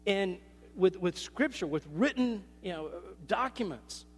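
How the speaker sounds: normal talking voice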